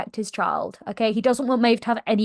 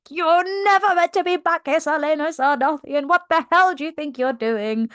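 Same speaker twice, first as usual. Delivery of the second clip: raised, higher voice, outraged at the intruder; angry pirate captain; 6 semitones higher than usual. Retold or imitated. Captain catching the queen at his desk, outraged raised voice. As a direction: bark it out like a furious captain. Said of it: "He's like".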